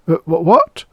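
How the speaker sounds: high, startled stammer. Acted. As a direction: trip over the words in shock. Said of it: "Engel stammered".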